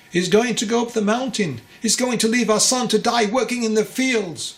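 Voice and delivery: high, loud anguished mother's voice; a mother's desperate groan. A narrator's reading. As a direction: wail it out as the frantic mother. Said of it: "groaned the mother".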